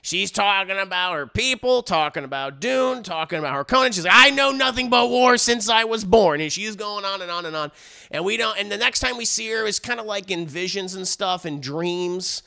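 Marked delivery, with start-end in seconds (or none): 4.1-6.4 loud, raised hammed-up declaration; mock-dramatic narrator. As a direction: imitate a film narrator, overdone and loud.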